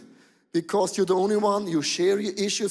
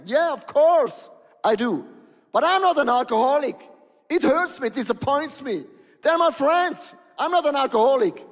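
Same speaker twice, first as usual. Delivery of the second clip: higher, louder protesting caller voice; indignant caller; 6 semitones higher, 4 dB louder than usual. Retold or imitated. Caller on TV insisting to the pastor he is no alcoholic, indignant. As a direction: insist you're not an alcoholic, offended.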